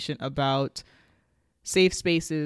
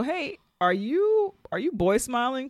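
teasing question; 8 semitones higher than usual. Retold or imitated. Sam asks Joelle about her crush, playful high-pitched teasing. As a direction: imitate a friend prying, pitch raised.

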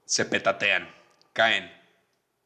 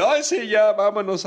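hurried panic; 8 semitones higher, 4 dB louder than usual. Retold or imitated. Apurados al transformarse.

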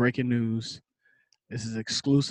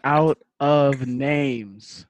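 slow; 4 dB louder, much slower than usual. Real, not acted. Slow joking admission.

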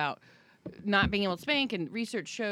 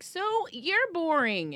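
mock retort; 9 semitones higher, slower than usual. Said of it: "And I was like".

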